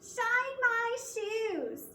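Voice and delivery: high, slow, shrill-edged order; a high, drawn-out bossy command; bossy stepsister. Acted. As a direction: demand it like a spoiled stepsister.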